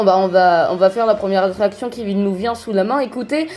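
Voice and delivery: ordinary delivery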